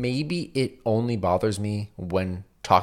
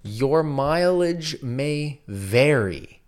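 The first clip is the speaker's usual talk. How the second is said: very slow, word by word; deliberate reveal; much slower than usual. Shown as a demonstration. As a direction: spell out the answer, drawn out. Revealing the acronym slowly.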